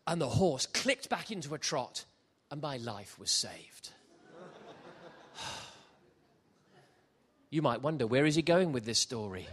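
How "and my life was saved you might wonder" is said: normal talking voice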